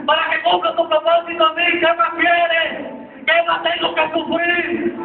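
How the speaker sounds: high, driving preacher's delivery; impassioned sermon storytelling; full-force preaching. Real, not acted.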